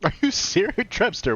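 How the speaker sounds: high, loud disbelief; can't believe what he just read; surprised. Real, not acted. Incredulous at chat's claim.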